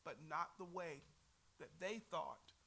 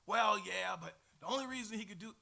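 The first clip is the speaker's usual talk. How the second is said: loud, fast grudging excuse; mocking Pharisee; 8 dB louder than usual. Teacher imitating Pharisees to class, loud fast mockery. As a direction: blurt the excuse like a sore loser.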